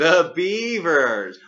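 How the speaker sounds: slow, drawn out, hammed-up cheer; exaggerated cheer. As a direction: stretch it out like a chant. Echoing with exaggerated enthusiasm.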